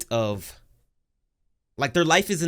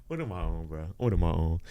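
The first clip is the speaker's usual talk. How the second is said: deep voice; 9 semitones lower, 8 dB quieter than usual. Pretend. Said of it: "Never do that again".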